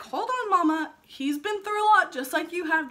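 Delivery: calm her down like the doctor did; reassuring doctor. Retold or imitated. Doctor reassuring the frightened new mother, soothing.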